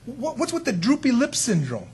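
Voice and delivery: God warning Cain. Retold or imitated. God urging Cain to overcome sin, rising to firm. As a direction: start caring, end with a firm charge. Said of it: "what had God just said to Cain".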